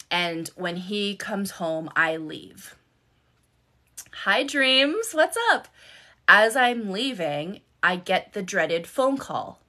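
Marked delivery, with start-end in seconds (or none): 4.2-5.7 high, loud, drawn-out hello; bright-pitched greeting. Real, not acted. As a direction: call out a hello to someone arriving. Greets arriving viewer, breaking off story.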